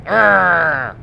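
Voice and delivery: a loud, drawn-out 'arrgh'; loud mock growl. Yelling after a speedy son of a gun, mock growl. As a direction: growl out a loud playful arrgh.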